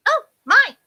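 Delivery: high, loud startled cry; half-deaf fairy. A narrator's reading. Half-deaf fairy finally hearing the curse, high-voiced. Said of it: "who was half deaf".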